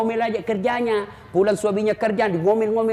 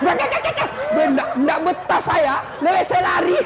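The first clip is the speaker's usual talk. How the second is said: mock panicked jinn; 9 semitones higher than usual. Jinn flees the nagging wife.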